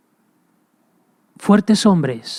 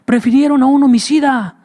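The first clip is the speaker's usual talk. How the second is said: high and louder, indignant; preacher repeats it raised and indignant; emphatic outcry; 7 semitones higher, 4 dB louder than usual. Shown as a demonstration. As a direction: cry it out in disbelief. Preacher repeats key line.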